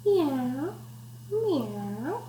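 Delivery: soft kitten meow, swooping up at the end. Pretend. Reader showing children a kitten's purr, soft meowing. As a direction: mew like a little kitten. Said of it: "Purr like a kitten".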